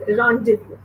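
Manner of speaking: rendezvous said French-style, "ron-de-voo"; three clean syllables, rendezvous as "RON-de-voo"; correct pronunciation. Shown as a demonstration. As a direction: model rendezvous with silent Z and S. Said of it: "It's pronounced as".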